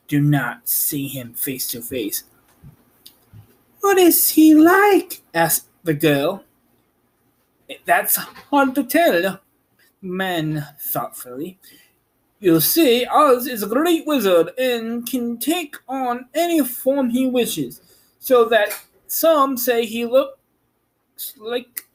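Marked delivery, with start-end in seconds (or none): 3.8-5.2 put-on high young girl voice, curious; Dorothy's girl voice. Girl asking about Oz, high and curious. 7.8-21.9 thoughtful man voiced high; higher character voice. Man explaining Oz to Dorothy, pitched up.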